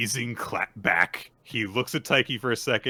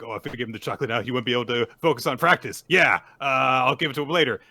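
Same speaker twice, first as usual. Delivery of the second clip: hurried, building to a loud outburst; mock inner scream; 4 semitones higher, 7 dB louder, faster than usual. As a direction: act out a mind racing in panic. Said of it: "she's, like, screaming in her own head".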